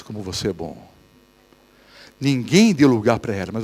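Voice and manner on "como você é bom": low and much softer, sly flattery; tempting devil voice. Acted. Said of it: "E vem aquela voz". (voz baixa e sedutora do diabo)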